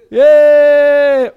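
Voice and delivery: long, high, very loud whoop; drawn-out celebratory yell; jubilant cheer. Acted. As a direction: cheer at full voice. Cheers the raising of the dead.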